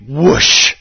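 loud, drawn-out whoosh; sword whoosh. Acted. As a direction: swing a sword with your voice. Imitating a sword swing.

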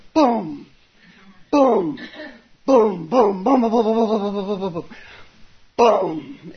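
imitated board strikes. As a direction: beat out each boom like a wooden gong.

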